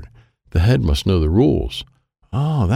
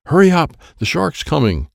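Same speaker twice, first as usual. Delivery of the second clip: urgent; 8 semitones higher than usual. A narrator's reading. Friend warns Troy the shark's coming, urgent. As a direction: hurry someone along in a higher voice.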